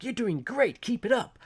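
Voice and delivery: high, bright app cheer, hammed-up; mock cheerful encouragement. App voice quoted to viewers, mock cheerful. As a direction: chirp it like an upbeat app voice. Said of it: "very generic messages like".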